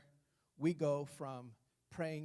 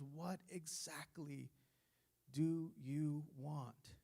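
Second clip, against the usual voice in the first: slow and softer, probing question; gentle searching question; 4 semitones lower, 4 dB quieter, much slower than usual. Acted. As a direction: ask it slowly, eye to eye. Jesus looking you in the eye.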